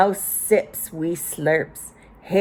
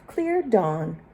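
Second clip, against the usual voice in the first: usual speaking voice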